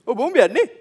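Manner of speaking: matter-of-fact onlooker reply; other bystander. Retold or imitated.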